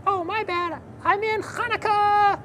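high excited puppet voice; squeaky eager kid puppet, bouncing with excitement; Nachman puppet voice. Acted. Puppet character speaks.

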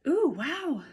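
high, loud pleased wow; delighted wow. Real, not acted. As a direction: sound truly impressed. Opening the next item.

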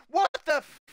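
high, loud cut-off yell of shock; real panicked shout. Real, not acted. Player reeling from the jumpscare, shouting in shock. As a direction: blurts it out mid-scare.

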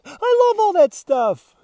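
blissful loud squeal; excited dog. Acted. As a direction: swoon over every smell. Dog raving about smells.

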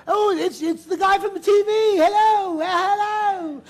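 high, loud gushing squeal of recognition; high-pitched mock fangirl shriek; excited star-struck greeting. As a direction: gush it high, stretching the last hello. Acts out star greeting him excitedly.

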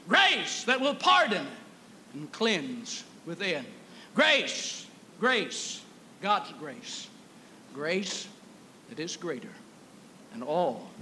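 hymn refrain recited. Real, not acted. Chanted hymn refrain fading.

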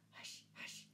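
unvoiced whispered shushing; hissed hush. A narrator's reading. Onlookers silencing a neighbour in the crowd, hissing. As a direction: hiss it softly under the breath. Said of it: "hissed one or two in the crowd".

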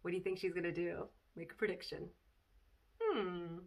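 usual speaking voice

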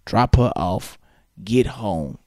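ordinary delivery

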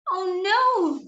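high, drawn-out storybook "oh no"; puffling's high wail of alarm; dismayed cry. Acted. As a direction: cry out in acted dismay, pitch up then falling. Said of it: "cried the puffling".

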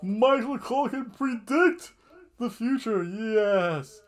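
loud, very high, hammed-up cheering; gleeful over-the-top proclamation; mock amazement. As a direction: shout it like an astonished crowd. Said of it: "and we're all like".

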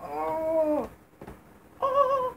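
usual speaking voice

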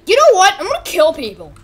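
high, loud, sudden resolve; defiant character outburst. Acted. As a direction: burst out with a playful villain's declaration. Robot character turns on people in play story.

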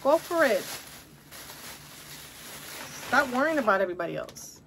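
encouraging mom. Retold or imitated. Mom answering her doubtful daughter, encouraging. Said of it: "I tell my kids that".

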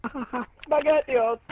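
high, very drawn-out Italian caricature; mock Italian accent. Player repeating his joke to laughter, stretched mock Italian accent. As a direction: sing out the word in mock Italian. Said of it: "a very racist Italian accent".

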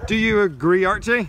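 loud question called across; calling out. Real, not acted. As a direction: call over to Archie. Calling a friend's opinion loudly.

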